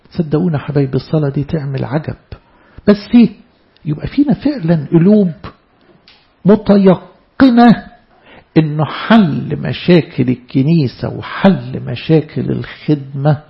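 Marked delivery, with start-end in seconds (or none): 6.5-7.9 متيقنة stretched very slowly, high and loud; متيقنة said slowly and loudly, as if carving it; drawn-out emphasis. Real, not acted. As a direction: lean hard on متيقنة, stretching every sound. Sermon emphasis on certainty.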